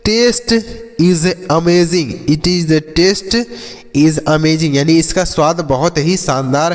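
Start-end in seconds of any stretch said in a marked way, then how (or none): 0.1-4.7 very slow, every word stretched; slow model sentence. Shown as a demonstration. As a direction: say it slowly so pupils can copy. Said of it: "जैसे". (धीरे-धीरे, शब्द खींचकर)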